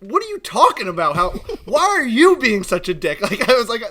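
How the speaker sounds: hammed-up wounded indignation; near-tears protest. Worker protesting he's just doing a pop-up shop, mock-upset. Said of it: "trying to make me cry".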